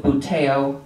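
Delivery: buteo said "boo-TAY-oh"; variant pronunciation. Shown as a demonstration. Lecturer offering audience pronunciation options, variant pronunciation of buteo as "boo-TAY-oh". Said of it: "You say tomato".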